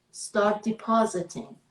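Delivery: ordinary talk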